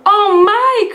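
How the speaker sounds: put-on old lady startled by the lion; startled old woman. A narrator's reading. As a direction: gasp it like a startled granny. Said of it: "cried the tiny old woman".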